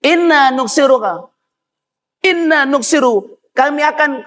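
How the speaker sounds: high-pitched, declaimed appeal; devils addressing their master. Acted. Iblis's family asking who angered their lord, in high declamation.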